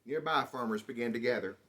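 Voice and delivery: usual speaking voice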